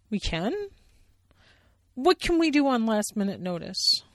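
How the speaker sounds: hopeful questioning bear; Sally Bear voice. Acted. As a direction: perk up doubtfully.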